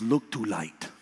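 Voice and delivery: low, soft imitated Russian accent. Russian nurse steering a nervous patient, low imitated Russian accent. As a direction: quietly instruct in a mock Russian accent. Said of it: "She goes".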